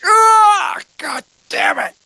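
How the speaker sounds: high, loud groan breaking into a curse; distant frustrated shout. Acted. Calic reacting from a hundred feet away, frustrated shout. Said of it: "a hundred feet away. He's like".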